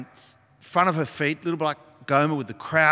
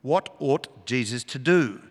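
usual speaking voice

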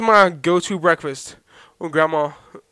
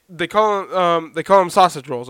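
normal talking voice

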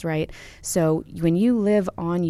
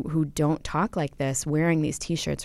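ordinary talk, nothing marked

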